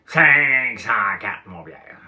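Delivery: loud, drawn out very slowly; big voice again. Retold or imitated. Speaker re-imitating Claude Cloutier's booming voice. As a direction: stretch Cinq cent quatre slow and loud. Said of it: "il parlait avec une grosse voix".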